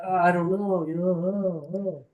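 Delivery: a hint of whiny, put-on reluctance; hesitant older person. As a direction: imitate a weary doubter moaning the words. Imitates hesitant fifty-year-olds.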